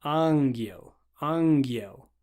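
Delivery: ангел with a hard G, ahn-gyol; Russian hard G. Shown as a demonstration. Teacher modeling the Russian word ангел. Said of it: "you'll be saying".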